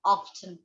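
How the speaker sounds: often with the t sounded, OFF-tun, falling; spoken t. Shown as a demonstration. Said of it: "Britishers होते हैं वो often बोलते हैं".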